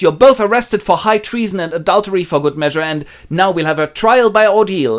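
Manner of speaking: loud, high pronouncement; stern Albany. Acted. Albany arrests both of them, loud verdict. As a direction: declare the arrest.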